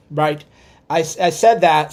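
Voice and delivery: normal talking voice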